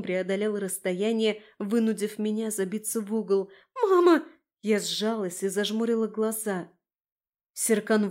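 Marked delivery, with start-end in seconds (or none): 3.7-4.2 loud, high panicked cry; frightened yelp; terrified cry. Acted. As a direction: cry out for mum in terror. Cornered by the leaping wolf.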